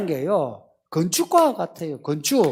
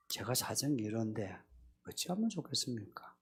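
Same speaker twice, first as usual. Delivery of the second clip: much softer, low, humble asking; quiet sincere prayer; 10 semitones lower, 20 dB quieter than usual. Shown as a demonstration. Preacher shows the congregation how to pray quietly. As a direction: speak to God softly and humbly. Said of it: "그렇게 하세요".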